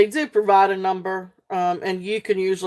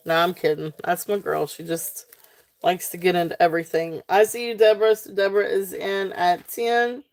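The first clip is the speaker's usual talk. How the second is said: ordinary talk, nothing marked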